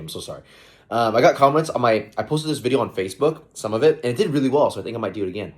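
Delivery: normal talking voice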